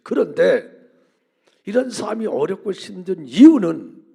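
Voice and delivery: loud, driving sermon delivery with every phrase hammered; full-force preaching. Acted. Preacher to congregation, full-force preaching. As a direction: preach it hard to the whole congregation.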